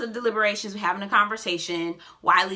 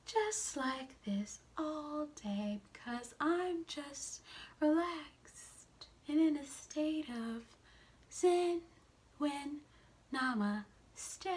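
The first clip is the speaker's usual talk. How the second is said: much softer, slow, even, droning zen voice; mock monotone; 6 semitones higher, 11 dB quieter, slower than usual. Vlogger mocking Ace's flat voice, slow soft monotone. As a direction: drone on flatly like a sleepy yogi. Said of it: "don't have enough inflection in her voice".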